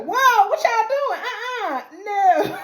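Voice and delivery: high-pitched mock alarm; alarmed protest. Marty objects to the people in his house, mock alarm. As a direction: act out a startled objection, way up high. Marty caught off guard by intruders.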